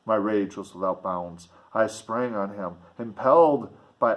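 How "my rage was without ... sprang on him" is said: plain reading voice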